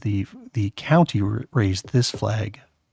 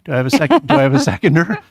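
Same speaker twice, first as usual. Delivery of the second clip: loud, rising auctioneer call, higher and louder; 8 semitones higher, 8 dB louder, much faster than usual. Acted. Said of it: "I sound like an auctioneer".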